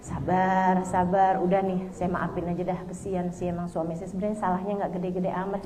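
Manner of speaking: low, soft inner voice; calm yourself down under your breath; self-soothing. Acted. Wife settling her heart to forgive her husband, soft and self-soothing. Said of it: "udah mulai nata hati".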